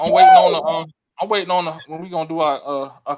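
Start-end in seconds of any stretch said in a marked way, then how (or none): 0.1-0.6 a sudden high, loud shriek; shrieked line. Real, not acted. Host calling for closing remarks, shrieking high. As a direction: squeal the words out through the laughter. Host still laughing, shouts.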